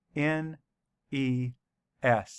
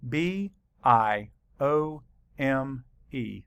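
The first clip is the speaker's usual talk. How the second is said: spelling out; slower than usual. Shown as a demonstration. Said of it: "let's write it".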